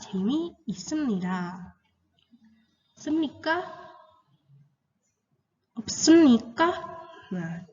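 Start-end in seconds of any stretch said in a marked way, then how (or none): 3.0-3.7 "Semnika" pitched higher, lifting at the end; rising final intonation. Shown as a demonstration. Teacher showing the question ending, "Semnika" with rising intonation. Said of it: "intonasinya harus naik ya di akhir". 5.8-6.7 "opsemnika" high and loud, ending on a lift; rising final intonation. Shown as a demonstration. Teacher demonstrating the negative question, "opsemnika" loud with rising intonation. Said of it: "intonasinya harus naik ya di akhir".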